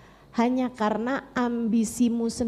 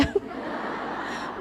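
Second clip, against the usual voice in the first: breathy laugh; 8 dB quieter than usual. Real, not acted. Preacher laughs at own joke.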